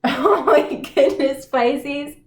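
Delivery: oh my goodness, half laughing in disbelief; surprised exclaim. Real, not acted. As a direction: blurt it out, shocked by the cards. Shocked by the card spread.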